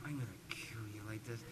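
menacing whispered threat, fast; threatening whisper. Retold or imitated. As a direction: hiss the threat under your breath, quick. Brother glaring at him onstage.

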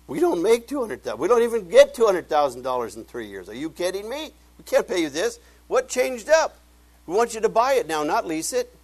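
loud, high-pitched incredulous protest; indignant outburst. Retold or imitated. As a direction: shout it like someone just robbed you. Outraged at the sudden price.

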